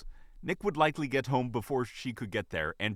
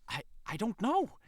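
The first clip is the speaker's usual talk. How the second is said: high, caught off guard; sudden startle; 12 semitones higher than usual. A narrator's reading. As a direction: blurt it out, flustered. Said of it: "she said suddenly".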